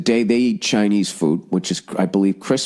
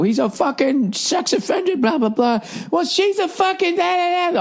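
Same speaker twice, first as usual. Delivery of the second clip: high squeaky mock shouting, much higher; mock yelling relatives. Quarreling relatives at Thanksgiving dinner, mock high squeal. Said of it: "everybody starts screaming and yelling".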